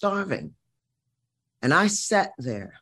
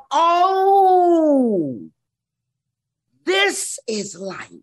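put-on awed child; 10 semitones higher, 4 dB louder, much slower than usual.

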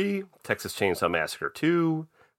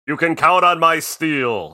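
warrior voice; 6 semitones higher, 6 dB louder, slower than usual. Retold or imitated. Quoting warrior line.

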